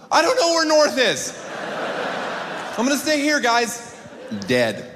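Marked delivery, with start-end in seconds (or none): 0.1-3.8 clueless whine. Retold or imitated. Comic answering group, high helpless whine. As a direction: sound hopelessly lost.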